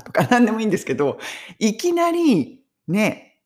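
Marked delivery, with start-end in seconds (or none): none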